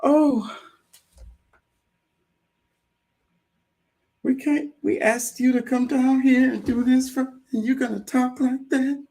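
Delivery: high, prissy mock-scandalized voice; mock shocked audience. Listener mocking the stuffy July crowd in a high scandalized squeal. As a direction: squeal it like a shocked genteel lady clutching her pearls. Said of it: "They were like".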